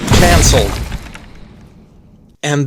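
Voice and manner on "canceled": loud, punched one-word proclamation; mock shouted verdict. Reviewer condemning a cartoon pig, mock shouted verdict. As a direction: boom it out like a hammed-up judgment.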